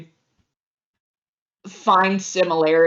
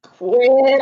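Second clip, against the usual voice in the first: short loud higher Quit; annoyed snap; 5 semitones higher, 7 dB louder than usual. Acted. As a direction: bark it to stop them. Agatha shoved into snow.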